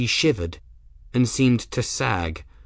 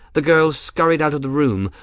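ordinary delivery, nothing marked

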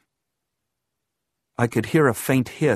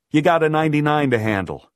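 quick, raised, louder command; urgent order; 5 semitones higher, 5 dB louder, faster than usual. A narrator's reading. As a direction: push her to move, fast and high.

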